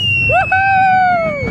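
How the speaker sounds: very high, loud whoop; thrilled whoop. Real, not acted. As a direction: let out a wild whoop. Coaster drop scream.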